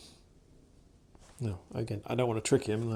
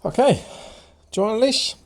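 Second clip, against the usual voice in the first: much higher, louder cheery offer to the dog; bright coaxing; 12 semitones higher, 13 dB louder than usual. Real, not acted. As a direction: perk up and invite the dog eagerly.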